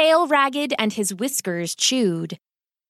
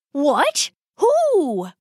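sudden high startled questions; jolted, a touch alarmed; startled father; 6 semitones higher than usual. Acted.

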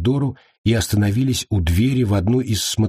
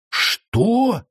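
high, mock-roared outburst; roared question. A narrator's reading. Tamba hearing who really called, roaring in disbelief. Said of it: "взревел Тамба".

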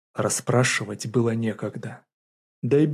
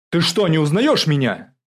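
loud, higher, indignant challenge; indignant shout; 5 semitones higher, 9 dB louder than usual. A narrator's reading. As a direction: demand to be recognised. Angry colonel confronts duty officer. (возмущённый окрик)

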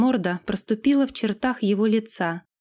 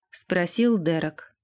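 ordinary delivery, nothing marked

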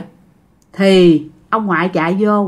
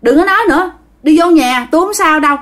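raised-pitch, dismissive insistence; stubborn refusal; 6 semitones higher, faster than usual. Acted. Grandpa shutting grandma down, stubborn refusal. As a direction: brush it off, pitched up. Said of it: "ông ngoại nhất quyết, ông ngoại không chịu".

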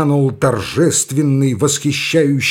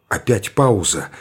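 ordinary delivery, nothing marked

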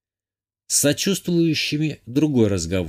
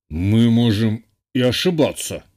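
mildly hesitant, halting rector's voice; unsure. A narrator's reading. Rector admits possible error to the narrator, unsure. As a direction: pause midway, as if doubting it. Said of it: "неуверенно сказал ректор".

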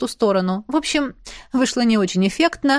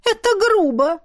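put-on higher, offended girl's voice; indignant; 9 semitones higher than usual. A narrator's reading. Izzy reproaches her brother, high and offended. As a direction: sulk out the reproach. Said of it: "возмутилась она".

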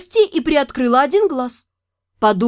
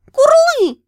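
high loud joyful creature call; loud high delighted dragon call; happy dragon chirp; 13 semitones higher, 5 dB louder than usual. Acted. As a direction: chirp for joy like a baby dragon. Dragon pushes the ball.

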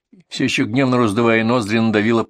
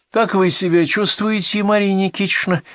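fake concern; 9 semitones higher than usual. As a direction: coo with obviously false care. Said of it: "с фальшивой, как четырёхрублевая монета, заботой в голосе".

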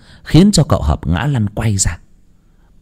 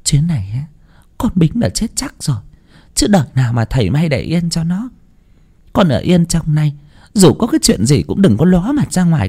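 high-pitched, pleading mother's voice; hushed worried warning, climbing to a tender plea; anxious mother; 8 semitones higher than usual. Acted. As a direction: urge your son to stay put, voice pitched high. Mother quietly warns injured son.

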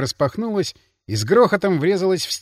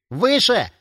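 higher-pitched, mildly squealing command; breaking into squeal; 5 semitones higher than usual. A narrator's reading. Nervous boy sentry orders the intruder, voice breaking upward. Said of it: "Голос часового сорвался на визг".

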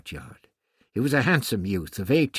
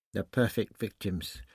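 ordinary delivery

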